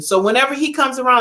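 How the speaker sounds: ordinary talk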